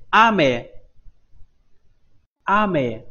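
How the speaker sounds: 阿美 with a strong 阿, falling away on 美; stress on first syllable. Shown as a demonstration. Teacher models the word 阿美 for rain, first syllable stressed. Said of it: "重读第一个哈".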